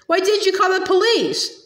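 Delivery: high-pitched, indignant question; reproachful questioning. A narrator's reading. Girl challenging aunt about the police, reproachful.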